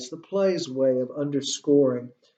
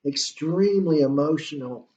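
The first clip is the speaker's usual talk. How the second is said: ordinary talk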